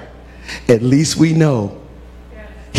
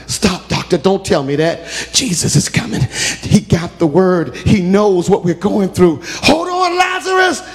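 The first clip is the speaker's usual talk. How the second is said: shouted high plea to hold on; desperate refusal; 3 semitones higher than usual. Acted. Martha pleading with the doctor, then shouting to Lazarus. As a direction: refuse the news, then cry out.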